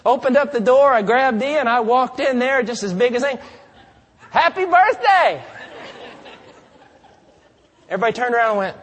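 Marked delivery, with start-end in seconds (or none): 4.3-5.4 high, loud, slow and beaming; cheery greeting. Retold or imitated. Preacher barging into a stranger's party, loud cheery greeting. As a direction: burst in with a big happy birthday.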